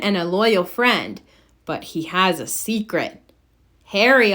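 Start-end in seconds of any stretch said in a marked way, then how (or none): none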